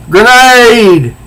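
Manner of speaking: high, loud yell of grenade; warning shout. Acted. Etta warning the heroines, a loud high shout. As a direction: call out the danger sharply.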